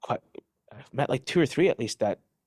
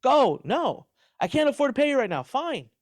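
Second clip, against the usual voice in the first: high, exasperated mock pleading; hammed-up pleading boss; pleading with the team; 12 semitones higher, slower than usual. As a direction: beg them to leave, half-laughing. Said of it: "I'm like".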